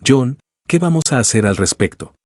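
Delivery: plain reading voice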